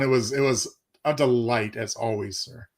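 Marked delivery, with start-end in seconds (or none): none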